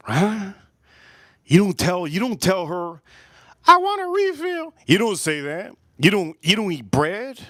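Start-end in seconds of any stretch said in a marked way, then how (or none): 3.7-4.7 squeaky high voice asking for a refill; a high little mocking voice; mock high request. Mocking a buffet customer's request.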